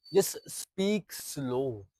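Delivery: very slow and soft. Shown as a demonstration. Coach showing a student how to talk to a girl, very slow. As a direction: draw each word out slowly. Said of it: "Next thing is speak slow".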